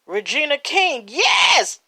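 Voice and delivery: loud, high cheering; excited cheer. Real, not acted. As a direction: shout it out with joy. Cheering favorite actress winning.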